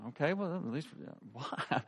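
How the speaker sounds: very soft, halting mock-timid voice; acted sheepish husband, barely audible; meek reply. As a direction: ask it small and cautiously. Acts his timid reply to angry wife.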